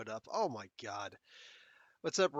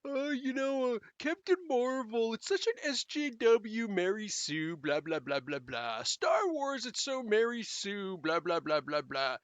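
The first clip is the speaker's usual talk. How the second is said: higher sneering whiny complainer voice; mock the online complainers; 6 semitones higher, slower than usual. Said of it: "people who are still complaining".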